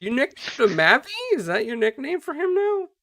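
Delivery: ordinary delivery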